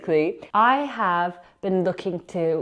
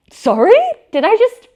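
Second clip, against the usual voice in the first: loud, much higher, shocked "Sorry? Did I just"; stunned disbelief; 11 dB louder than usual. Retold or imitated. Vlogger recalling her reaction to the baker's rude message, loud and shocked. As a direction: blurt it out in outraged disbelief.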